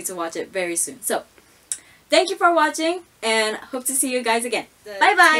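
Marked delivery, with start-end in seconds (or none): 2.1-5.4 cheerful sign-off. Real, not acted. Vlog sign-off.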